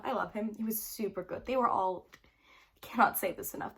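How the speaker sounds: normal talking voice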